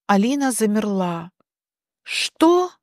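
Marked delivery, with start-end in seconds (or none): 2.0-2.7 high-pitched rising disbelief; acted shock; stunned question. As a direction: ask as if the news just hit. Alina stunned by fire news.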